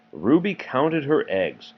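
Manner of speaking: usual speaking voice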